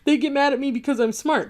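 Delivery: giggly, self-satisfied mock gloating; happy narcissist. Imitating a narcissist pleased he angered people, gleeful and smug. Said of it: "he's happy as hell".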